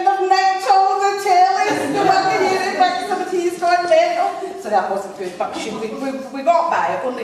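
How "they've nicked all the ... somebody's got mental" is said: panicked loud wail. Retold or imitated. Colleague wailing that the tellies were nicked. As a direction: wail it like the tellies are gone.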